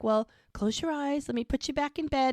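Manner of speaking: high-pitched coaxing, retold; soothing baby talk. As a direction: settle a fussy baby into bed. Retold man settling baby to bed.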